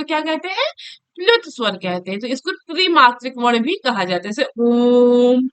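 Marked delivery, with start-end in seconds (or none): 4.6-5.5 ओम drawn out slowly over three beats; prolonged pluta vowel. Shown as a demonstration. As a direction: stretch the ओम long, a three-measure vowel. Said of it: "थोड़ा time लग रहा है". (ओम को तीन मात्रा तक खींचकर बोलें)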